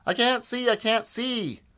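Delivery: high-pitched villain wail; panicked cry. A narrator's reading. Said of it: "cried Doctor Diaper".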